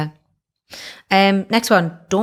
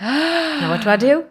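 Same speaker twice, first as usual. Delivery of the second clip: a touch of mock panic, rising high and slower; panicked; 10 semitones higher, slower than usual. Recalling her bluff working, mild mock panic. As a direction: play your own panic lightly, high. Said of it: "And then I was like".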